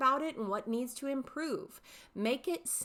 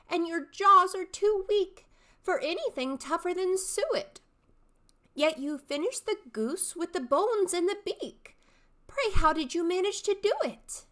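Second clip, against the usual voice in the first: high, bright-eyed questioning youth; young man's voice; 10 semitones higher, 6 dB louder than usual. Shown as a demonstration. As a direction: pester the old man, pitched high. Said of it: "three distinct character voices".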